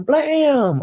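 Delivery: high, loud mock gunshot; kid-style gun noise; gunshot blam. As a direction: bark it like a shotgun blast. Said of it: "gunshot sound effect".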